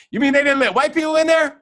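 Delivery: high, loud mock-incredulous Leonard; imitating Leonard. Host mimicking friend Leonard, loud mock disbelief. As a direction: play the buddy's joking disbelief, big. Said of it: "If I was to say something that Leonard would say right now, he'd go".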